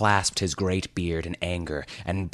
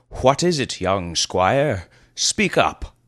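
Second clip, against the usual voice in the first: put-on prompting old lord; 3 semitones higher, 5 dB louder, slower than usual. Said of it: "prompted Eldrador".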